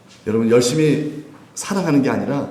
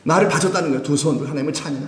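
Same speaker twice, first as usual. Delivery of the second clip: normal talking voice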